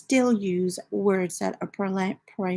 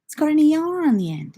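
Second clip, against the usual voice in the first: higher, insistent retold correction; retold protest; 7 semitones higher than usual. Wife retells correcting her husband's pronunciation, indignant mock protest. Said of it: "And I'm like".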